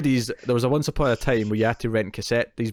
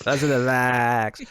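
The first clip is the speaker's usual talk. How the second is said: mock film quote; much slower than usual. Echoes co-host's drawn-out film quote.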